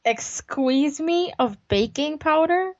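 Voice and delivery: slow, high, questioning catchphrase; Wayne's World quote. Retold or imitated. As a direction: draw it out as a baffled movie quote. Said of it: "That was a Wayne's World thing".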